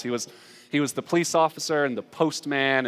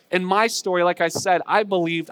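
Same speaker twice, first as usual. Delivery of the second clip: ordinary talk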